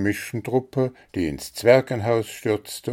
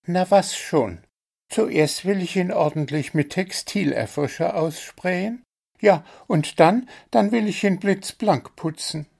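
small bird voice; 11 semitones higher than usual. A narrator's reading. Zwitschi explains his plan.

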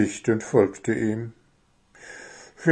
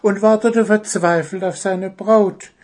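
higher-pitched storytelling bird voice; high little bird, lively and wordy; chattering little bird; 7 semitones higher than usual. Acted. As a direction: play a small bird lost in a tale, then caught short.

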